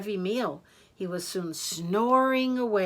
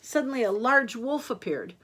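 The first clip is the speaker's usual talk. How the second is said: ordinary delivery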